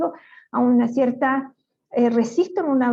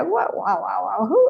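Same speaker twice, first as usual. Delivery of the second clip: playful woof woof, first bark pitched up; mock dog bark; 3 semitones lower than usual. Explaining speech to an interviewer, imitates barking. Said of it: "el otro ladra".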